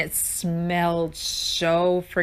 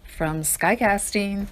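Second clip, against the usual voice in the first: announcer intro; slower than usual. Acted. Podcast intro announcer greeting.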